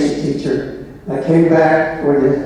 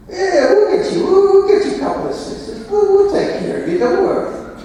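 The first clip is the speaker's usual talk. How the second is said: much higher, hammed-up voice of the boss; mock boss voice; faster than usual. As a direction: squeak out the boss's breezy promises, fast. Bauer voiced, promising assistants.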